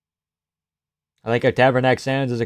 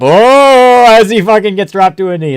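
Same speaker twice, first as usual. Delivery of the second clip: much higher, louder burst at the knockdown; excited shout; 8 semitones higher, 10 dB louder than usual. Real, not acted. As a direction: yell it as the fighter drops. Reacting to knockdown.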